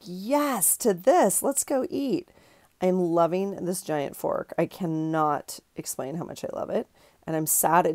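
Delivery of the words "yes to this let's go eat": excited cartoon raccoon. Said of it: "He's like".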